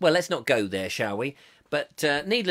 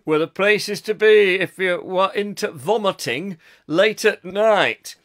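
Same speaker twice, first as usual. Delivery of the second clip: put-on slow, deliberate Northern English accent; 4 dB louder than usual. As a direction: read it out like an old Northern lad. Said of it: "an up north accent".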